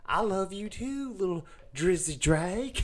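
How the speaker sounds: slow, doting mom voice. Retold or imitated. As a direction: coo it like a loving mom. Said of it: "I just did your mom's voice".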